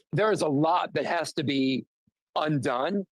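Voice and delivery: ordinary talk, nothing marked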